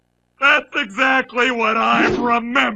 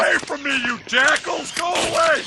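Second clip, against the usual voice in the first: shouting; 7 semitones higher than usual. Acted. Bee chases off paparazzi.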